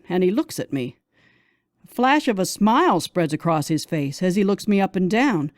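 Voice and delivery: usual speaking voice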